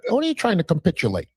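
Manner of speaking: quick, mock-educated delivery; fancy-word voice. Shown as a demonstration. [name] shows off fancy vocabulary, in a mock-educated voice. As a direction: toss off a big word like a professor. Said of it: "I got all them cake-ass words".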